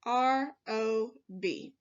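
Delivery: letters spelled out slowly, one by one; teacher spelling rob slowly for the workbook. Shown as a demonstration. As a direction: spell it out slowly so children can copy. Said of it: "just like this".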